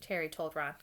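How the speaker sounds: ordinary delivery, nothing marked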